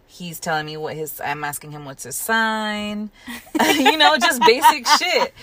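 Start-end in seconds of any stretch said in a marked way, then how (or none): 3.1-5.3 high and loud through a laugh. Real, not acted. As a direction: laugh it off as no big deal. Laughing about astrology questions.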